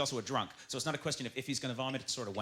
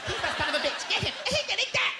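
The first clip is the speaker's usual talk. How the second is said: high, loud mock battle cry; shrill-free hammed-up charge order; mock girl gang; 4 dB louder than usual. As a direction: girls rallying to attack. Voicing angry Japanese girl mob.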